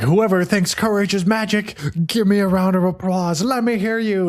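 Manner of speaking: rally the crowd like an emcee; mock host working the room; hype host. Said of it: "And Cauldron is like".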